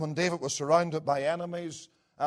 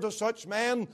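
ordinary talk

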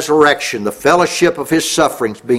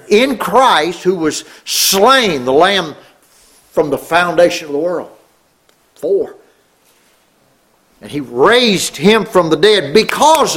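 emphatic preaching; 4 semitones higher than usual. Real, not acted. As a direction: proclaim it, stretching each big word. Preaches doctrine with force.

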